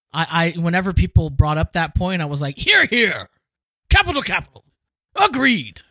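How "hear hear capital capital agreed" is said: high-pitched, mock-pompous cheering; hammed-up parliamentary assent; hearty gentlemanly approval. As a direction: play an old club member heartily agreeing. Acting out hearty old-fashioned agreement.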